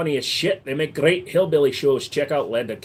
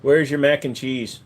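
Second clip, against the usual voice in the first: ordinary talk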